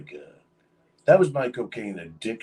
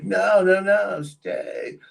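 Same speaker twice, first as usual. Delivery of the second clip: higher, insistent no, no, no; pleading manager; 10 semitones higher, much slower than usual. Retold or imitated. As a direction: beg him not to leave. Manager begging him to stay.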